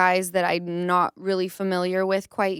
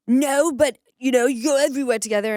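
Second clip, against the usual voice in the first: higher, fast mock of James backpedaling; 6 semitones higher, 3 dB louder than usual. Retelling James's excuse in a higher mock voice. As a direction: play him weaselly and fast. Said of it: "And he goes".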